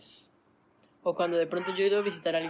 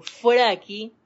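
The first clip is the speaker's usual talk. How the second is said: loud command, casting it out; loud banishing shout; firm rebuke; 4 semitones higher, 11 dB louder than usual. Acted. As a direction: order it away with force. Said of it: "le digo".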